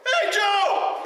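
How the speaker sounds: loud high shout across the water; calling out. Retold or imitated. Jerry calls to Joe from the shore, shouting loud and high. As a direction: yell his name from far down the shore.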